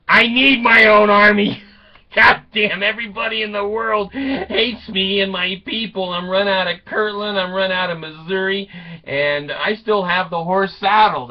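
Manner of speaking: loud, high outburst, easing as it goes; hammed-up aggrieved tirade; mock rant. Prophet demanding his own army, loud indignant rant. Joseph rants about needing an army.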